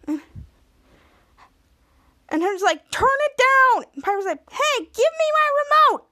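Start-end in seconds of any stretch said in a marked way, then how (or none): 2.9-3.9 high, loud irritated shout; acted yell. Brother yells about loud television. 4.5-6.1 high, loud sibling demand; acted demanding yell. Sister demands remote back.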